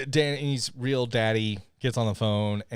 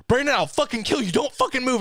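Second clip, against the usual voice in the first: higher, louder pretend furious threat; mock threat; 12 semitones higher, 4 dB louder than usual. Pretending Emmy threatens Brandon, hammed-up rage. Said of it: "that's like Emmy saying".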